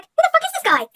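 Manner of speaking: mocking Kevin. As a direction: squeal it like a baffled Kevin. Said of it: "Kevin's like".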